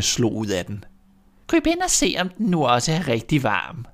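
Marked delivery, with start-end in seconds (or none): 1.5-3.9 very high, sing-song witch coaxing; wheedling witch voice; sly witch. Acted. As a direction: lure the girl into the oven. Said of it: "sagde hun".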